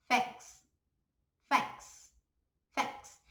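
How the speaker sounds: "Thanks" said as fenks, th sounding like F. Shown as a demonstration. Teacher drills Thanks with an f-like th. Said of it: "É quase como se fosse um F".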